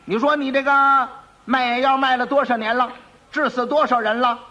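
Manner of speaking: high, forceful interrogation; angry magistrate. Acted. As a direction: demand answers from the accused in court.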